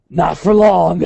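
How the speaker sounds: imitated gruff cartoon-hero growl; Wolverine. Fan quoting Wolverine after Jubilee's blast, gruff imitation. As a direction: quote the comeback in a put-on gruff voice. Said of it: "Wolverine just goes".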